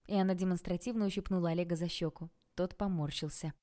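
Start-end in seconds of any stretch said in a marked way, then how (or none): none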